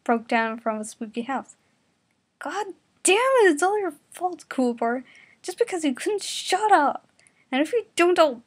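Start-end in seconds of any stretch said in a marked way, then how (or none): 2.4-8.4 high, loud angry tirade; angry driver blaming Kuwabara; furious rant. Acted.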